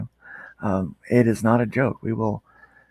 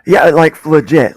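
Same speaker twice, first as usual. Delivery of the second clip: excited; 5 semitones higher, 11 dB louder, slower than usual. Real, not acted. Excited agreement after the pitch.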